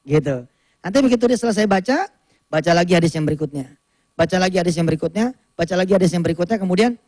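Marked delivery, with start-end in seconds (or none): 4.2-6.6 fast, rattling through the next hadith; fast back-to-back reading turns; quick rotating reading. Shown as a demonstration. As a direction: speed it up as each turn rolls on. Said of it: "Boleh saya contohkan cara bacanya".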